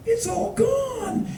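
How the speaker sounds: slow, high, thin wail; comic dismay. Retold or imitated. Tourist finds his coffee finished, high mock dismay. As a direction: lament it softly in a squeaky voice.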